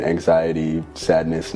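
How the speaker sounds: ordinary talk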